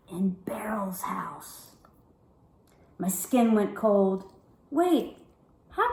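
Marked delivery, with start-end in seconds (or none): 0.1-1.7 slow breathy hush, unvoiced; slow, haunted whisper. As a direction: breathe it out like a secret. Eerie line in a dream story.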